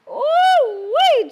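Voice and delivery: high, loud excited whoop. Real, not acted. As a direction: let out a joyful ooh-wee. Excited at cheese pouring.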